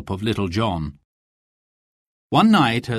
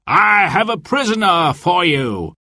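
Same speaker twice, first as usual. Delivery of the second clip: high, triumphant sheriff's call; raised-pitch theatrical holler; crying out; 6 semitones higher, 4 dB louder than usual. A narrator's reading. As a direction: shout it proudly to an ally. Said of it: "cried the sheriff".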